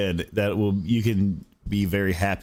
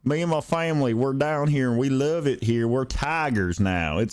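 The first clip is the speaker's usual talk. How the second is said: lampoon a coach's put-on Southern twang; light mock Southern accent; 4 semitones higher than usual. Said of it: "he's like".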